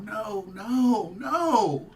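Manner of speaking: real grief swelling; rising wail. Real, not acted. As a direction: let the denial break into a cry. Reacting to the death news.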